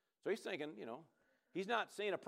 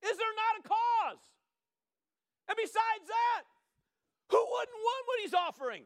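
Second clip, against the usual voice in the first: rising to high, loud shouting; building preaching shout; 9 semitones higher, 5 dB louder than usual. Real, not acted. Preacher rousing the congregation, building to a shout. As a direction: preach it up to full force.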